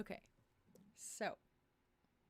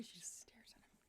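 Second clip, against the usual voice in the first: whisper; 3 semitones higher, 14 dB quieter than usual. Real, not acted. Amused aside about the cat.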